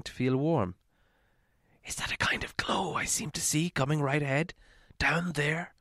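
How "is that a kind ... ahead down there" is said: hushed, breathy whisper of wonder; whispered thought. A narrator's reading. Bilbo whispering to himself in the tunnel. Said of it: "he thought".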